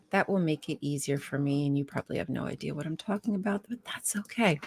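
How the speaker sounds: quick whispered side remark; whispered aside. Real, not acted. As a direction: whisper it to yourself, fast. Aside while moving a window.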